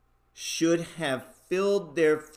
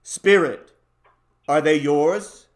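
urgent plea; 7 dB louder than usual. Acted. Scrooge's appalled plea to spirit.

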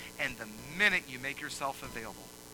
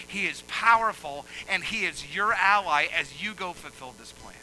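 loud, high preaching cadence; fiery pulpit delivery; full-force preaching; 4 semitones higher, 8 dB louder than usual. Acted. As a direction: preach it out to the hall.

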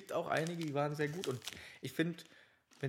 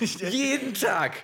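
laughing; 8 semitones higher, 9 dB louder, much slower than usual. Real, not acted. Laughing banter.

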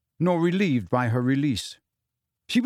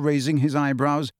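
plain reading voice

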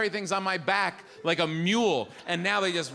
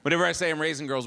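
ordinary talk

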